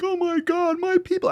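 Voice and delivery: very high, whiny mock crying celebrity. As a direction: parody a weepy pop star. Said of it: "when [name] goes online, like".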